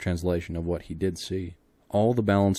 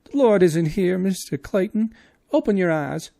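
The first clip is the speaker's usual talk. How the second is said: high urgent voice; 13 semitones higher, 11 dB louder than usual. A narrator's reading. Clayton pleading, wrong voice.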